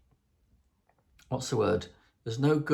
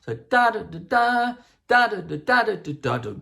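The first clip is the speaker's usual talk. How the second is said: much higher, louder punched das; accented phrase; 9 semitones higher, 6 dB louder than usual. Shown as a demonstration. Composer demonstrating how accents sound. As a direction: punch every accented note. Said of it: "that means you accent it".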